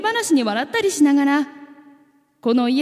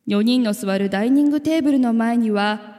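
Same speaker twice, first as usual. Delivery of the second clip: plain reading voice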